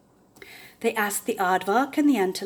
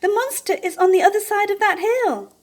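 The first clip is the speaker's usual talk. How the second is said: high, loud excited warning; excited squeak; 12 semitones higher, 7 dB louder than usual. A narrator's reading. Basil warns the others, high and excited. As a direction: point it out breathlessly.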